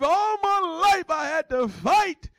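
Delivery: high, drawn-out dramatic movie-line impression; Oprah Winfrey. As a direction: act it out slow and high, full melodrama. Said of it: "Be like Oprah Winfrey".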